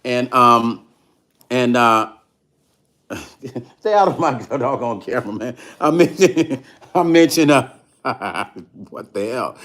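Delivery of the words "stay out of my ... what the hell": laughing through the words; can't stop cracking up. Real, not acted. Laughing at camera intruder.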